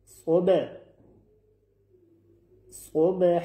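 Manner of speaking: صبح ending on a clear breathy ح; صبح, final h fully voiced out; clear pausal h. Shown as a demonstration. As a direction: let the ح of صبح ring clear at the stop. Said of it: "حرف ح شفاف".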